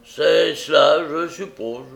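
slow, loud, grumbling agreement; growling. A narrator's reading. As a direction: growl it out begrudgingly. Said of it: "gronda Sykes".

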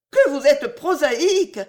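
high, loud indignant exclamation; indignant cry. A narrator's reading. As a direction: cry out in affronted reproach. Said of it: "s'écria Héloïse indignée".

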